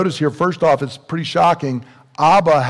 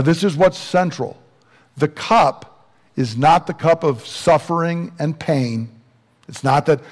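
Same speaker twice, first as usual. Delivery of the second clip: normal talking voice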